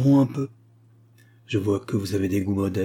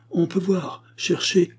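higher-pitched, mildly testy protest; slightly irritated; 8 semitones higher, slower than usual. A narrator's reading. Matchmaker holds back a leaving client, slightly irritated. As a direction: push back with a touch of impatience. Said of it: "dit-il légèrement irrité".

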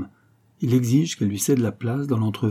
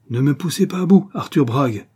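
loud, high outburst of warning; cornered woman's raised-voice threat; crying out; 5 semitones higher, 5 dB louder than usual. A narrator's reading. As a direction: exclaim it, pitched up and forceful. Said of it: "s'écria-t-elle".

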